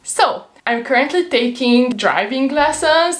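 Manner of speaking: ordinary talk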